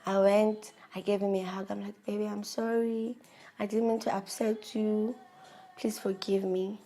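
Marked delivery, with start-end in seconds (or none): 2.1-6.8 hushed, contrite plea; soft apology. Retold or imitated. Wife hugs returned husband, apologizing softly. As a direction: ask forgiveness softly, keeping it small.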